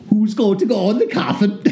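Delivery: high demon voice ending in a cackle; gleeful demon. Acted. Demon asking who goes in the coffin, high and gleeful. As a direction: ask it like a delighted imp. Demon asks, then laughs.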